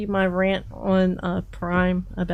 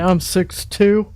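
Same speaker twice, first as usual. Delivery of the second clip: mock boast; 6 dB louder, slower than usual. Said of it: "Watch her say".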